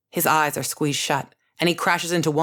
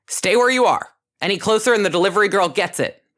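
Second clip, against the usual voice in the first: loud high threatening call, fast at the end; 5 semitones higher, 4 dB louder than usual. A narrator's reading. Captor threatening the enforcers across the van, loud shouted warning. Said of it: "Then it calls out".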